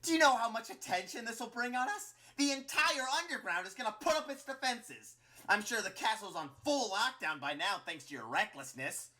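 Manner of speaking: high-pitched, ranting villain; angry scolding. A narrator's reading. Flowey berating Clover for recklessness, angry scolding. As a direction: berate someone in a high, furious voice.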